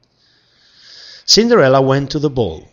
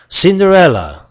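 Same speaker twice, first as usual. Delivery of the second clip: excited call; 7 semitones higher, 7 dB louder than usual. Acted. Excited announcement.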